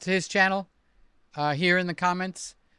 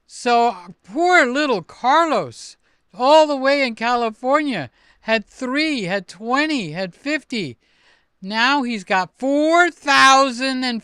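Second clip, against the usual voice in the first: loud, high, drawn-out disbelief; amazed hype; 3 semitones higher, 7 dB louder, slower than usual. Real, not acted. Streamer marvels at Carlos's subscriber jump, amazed hype. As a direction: marvel loudly, stretching the big words.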